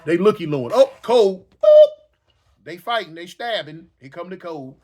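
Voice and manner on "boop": high, loud beep sound; alarm beep imitation. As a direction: blurt a sharp beep.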